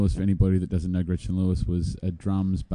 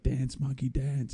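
mock heckler; 7 semitones higher, 3 dB quieter, slower than usual. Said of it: "they're just like".

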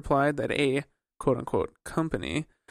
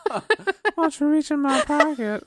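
creepy voice; 12 semitones higher, 3 dB louder than usual. Pretend. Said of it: "Do the move".

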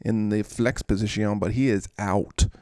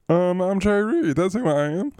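player voice; 8 semitones higher, 3 dB louder than usual. Pretend. Host pretends to be Jay Reed, high put-on voice. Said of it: "I don't know who he is".

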